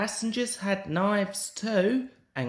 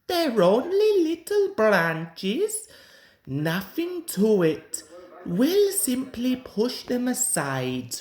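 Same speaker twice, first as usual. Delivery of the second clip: boastful engine; 6 semitones higher than usual. A narrator's reading. Cocky engine brushing off crew.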